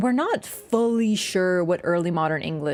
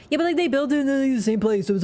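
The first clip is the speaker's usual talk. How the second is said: normal talking voice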